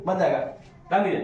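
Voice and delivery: ordinary delivery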